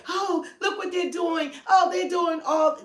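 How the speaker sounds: mock outraged shout. Mocking riled-up Democrat voters, shrill mock outrage. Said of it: "you supposed to be like".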